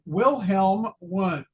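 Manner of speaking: slow American gringo accent, plain English W in Wilhelm Wundt. Shown as a demonstration. Lecturer mocking those who don't know better, gringo accent. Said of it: "say it with a gringo accent".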